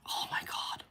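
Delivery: hushed whisper, fading out; whispered aside. Real, not acted. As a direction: share a scandalous aside under breath. Gossiping aside.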